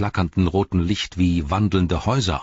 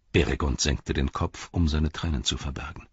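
ordinary delivery, nothing marked

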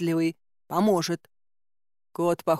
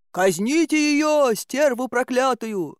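drawn-out higher yell; crowd shout; 5 semitones higher, slower than usual. Acted. Angry townsman to the crowd, calling for the witch's death, slow high shout.

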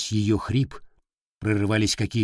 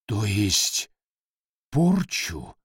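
trembling voice; much slower than usual. Acted. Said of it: "дрожащим голосом уточнил он".

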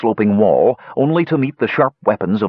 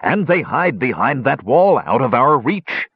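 ordinary delivery

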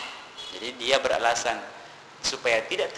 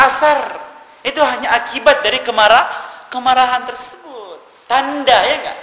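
loud, high, forceful sermon voice; fiery preaching; 7 semitones higher, 10 dB louder than usual. Acted. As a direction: thunder the challenge at the congregation. Preacher hammers cause versus effect. (khotbah berapi-api, suara keras dan tinggi)

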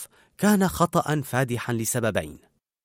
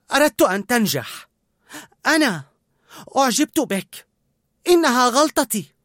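higher and louder, upset; confessing through hurt; tearful confession; 11 semitones higher, 4 dB louder than usual. A narrator's reading. Colette admitting she liked him, tearful. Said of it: "وأخذت نفسا عميقا وأكملت".

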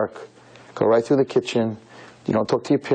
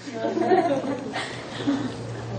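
a short high laugh bursting out; 11 semitones higher, 7 dB quieter than usual. Real, not acted. Laughs at his own joke.

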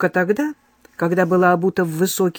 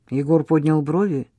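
plain reading voice

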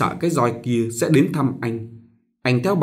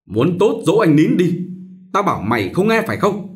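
higher, louder angry yell; shouting mistress; 6 semitones higher, 3 dB louder than usual. Acted. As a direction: bark at the servant girl. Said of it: "Bà quát".

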